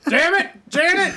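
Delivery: high loud mock shout; shouted quote. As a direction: belt it out, hammy and high. Sudden quoted outburst.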